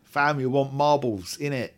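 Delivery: half-hearted London street hustler pitch; mock London slang. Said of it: "that accent".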